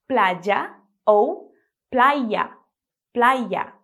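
playa slowly, pladja then playa; two ways of y. Shown as a demonstration. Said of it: "você pode dizer".

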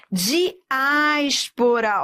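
Diáspora stretched very slowly into syllables. Shown as a demonstration. Teacher separates Diáspora for the class, very slow. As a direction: pull Diáspora apart slowly. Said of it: "teacher syllabifies word".

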